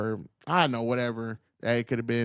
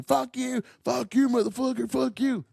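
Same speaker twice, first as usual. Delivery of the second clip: mock yelling crowd; 8 semitones higher than usual. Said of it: "hostile people yelling at you".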